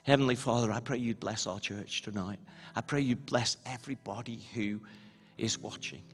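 soft, low intercession; quiet prayer. Real, not acted. Pastor praying for church and those watching, quiet earnest prayer.

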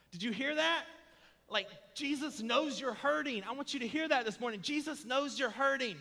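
high, loud, driving sermon delivery; impassioned preaching. Real, not acted. Preacher assuring hurting people Jesus cares, impassioned preaching. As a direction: press it home to the congregation with full force.

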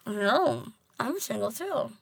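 talking while chewing food; words round a bite; mouth full. Real, not acted. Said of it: "Please stop eating and talk".

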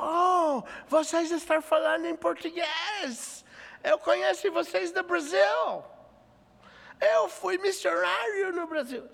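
put-on very high, loud, delighted old man's voice; excited old missionary. Old missionary overhearing Portuguese, very high excited exclamation. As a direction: gush like a thrilled elderly foreigner recognizing Brazilians. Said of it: "Falando desse jeito".

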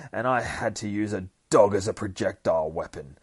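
high, loud exasperated rant; acted indignant tirade; losing his shit. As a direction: rant in outrage, starting high and easing slightly. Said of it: "Dakin fucking just loses his shit".

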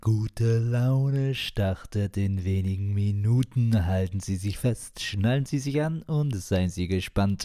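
smooth, slow announcer voice; flight attendant. Acted. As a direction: welcome passengers aboard, unhurried. Podcast intro as train announcement.

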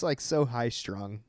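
normal talking voice

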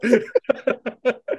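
high burst of real laughter; amused, high-pitched laughing fit. Real, not acted. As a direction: crack up helplessly. Cracks up at the lyrics.

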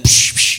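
sharp voiceless "psh" bursts; mock smiting sound effect, psh psh; imitated zapping noise. Preacher mimicking Old Testament God striking people down, zapping noise. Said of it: "God is always like that".

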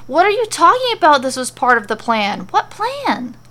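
a touch of a confused shriek, rising; mild hammed-up bewildered cry. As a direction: protest in bewildered disbelief. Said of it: "Mandy shrieks confusedly".

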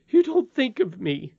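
high, loud self-pitying whine; a nurse feeling sorry for herself; whiny complaint. Acted.

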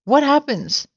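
high, alarmed question; gasped. A narrator's reading. Frightened Mrs Twit asking about the shrinks, gasping. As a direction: ask it high, in storybook fright. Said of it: "gasped Missus Twit".

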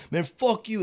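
high yelled curse, retold; mock angry dad. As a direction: imitate the furious Italian dad swearing. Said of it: "the Italian dad is like".

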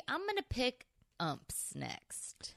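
slow secretive whisper. Real, not acted. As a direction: lean in and whisper it slowly. Whispered announcement of next topic.

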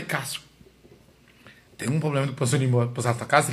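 low, hushed favour-asking; quiet, careful approach to a friend; discreet request. Retold or imitated. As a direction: ask softly and sheepishly, pitched right down.

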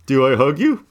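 a hint of a puzzled cartoon character asking; Homer Simpson. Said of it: "a Homer asking in a Simpsons".

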